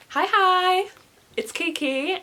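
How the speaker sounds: high excited vlog hello; bubbly intro. Real, not acted. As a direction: greet the viewers with a burst of energy. Intro greeting.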